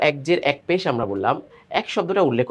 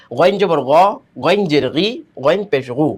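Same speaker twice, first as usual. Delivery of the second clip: loud drill recitation; 3 dB louder, slower than usual. Shown as a demonstration. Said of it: "তাড়াতাড়ি".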